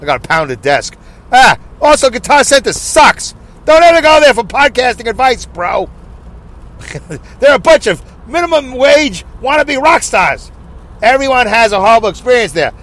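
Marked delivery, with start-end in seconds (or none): 1.3-12.7 high, projected mock rant; Alex Exum impression. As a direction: mimic him ranting, pitched up. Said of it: "the Alex impression".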